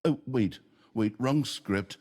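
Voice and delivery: ordinary delivery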